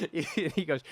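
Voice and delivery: words broken by laughter; laughing. Real, not acted. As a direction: laugh through it.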